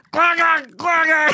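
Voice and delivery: high, loud garbled gurgle; gargling creature noise. Retold or imitated. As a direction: gurgle it like a silly alien. Imitating the alien leader's noise.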